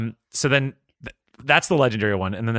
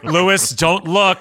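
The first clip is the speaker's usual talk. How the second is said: high, loud, drawn-out mock call; hammed-up, high and slow; mock playful line; 8 semitones higher, 6 dB louder, much slower than usual. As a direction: call it out slowly through amusement. Jokey imagined line, laughing.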